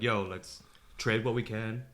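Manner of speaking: usual speaking voice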